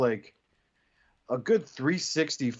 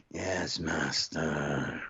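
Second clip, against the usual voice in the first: much lower, very slow entranced monotone; hypnotized robot drone; 9 semitones lower, 3 dB quieter, much slower than usual. Retold or imitated. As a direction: answer like a mind-wiped servant. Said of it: "his Headmaster's like".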